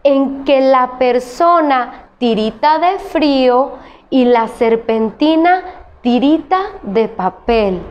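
slow reading. Shown as a demonstration. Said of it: "Vamos a leerlo".

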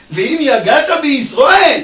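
slow and loud, driving the question home; emphatic shout. Acted. Preacher challenging the congregation, slow emphatic shout. Preacher pressing the verse's contradiction.

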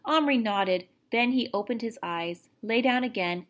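ordinary delivery

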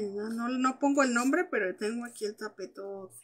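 usual speaking voice

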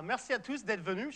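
ordinary talk

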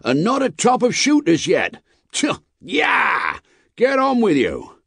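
high, loud cry urging the horses on; vexed coachman. A narrator's reading. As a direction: shout at the team in exasperation. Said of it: "the vexed coachman".

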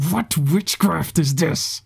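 loud, slow shout. A narrator's reading. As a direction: shout out in alarm. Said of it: "the leader shouted out".

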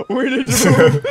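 breaking into high laughter; laughing panic. Real, not acted. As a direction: say it while cracking up. Laughing panic as Jason approaches.

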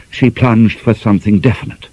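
ordinary delivery

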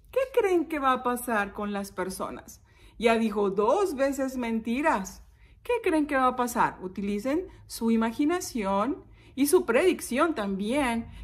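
ordinary delivery

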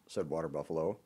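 usual speaking voice

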